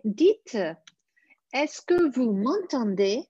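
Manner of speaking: ordinary talk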